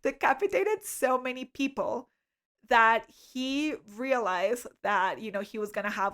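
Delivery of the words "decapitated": giggling, high and breaking, barely getting it out; laughing through words. Real, not acted. Telling her friend Loretta a date story, laughing helplessly. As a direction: try to say it but keep cracking up. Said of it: "can't even talk about this with a straight face".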